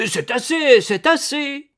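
panicked shout, high and loud. Acted. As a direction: yell for it to stop. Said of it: "et Yvon criait".

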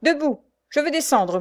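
high-pitched, insistent order; impatient demand. A narrator's reading. Prisoner wants off the cart, impatient demand.

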